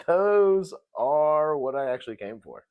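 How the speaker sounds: loud, high, hammed-up change of heart; mock sincerity. Podcaster imitating a player after she's eaten, exaggerated earnestness. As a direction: overplay sudden caring, breaking into laughter. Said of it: "And then she was like".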